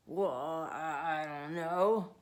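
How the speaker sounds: lightly voiced, faltering sailor; hesitating. Sailor hesitates at the door. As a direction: stumble over the words. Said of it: "Mister Whiskers hesitated".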